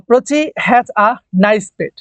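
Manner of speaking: very slow, each word clear; slow example reading. Shown as a demonstration. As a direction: read the sentence out for the class. Teacher reading example sentence aloud.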